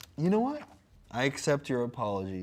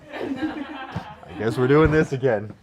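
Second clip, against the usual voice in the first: loud laughing, slowed down; laughing loudly; 12 dB louder, slower than usual. Real, not acted.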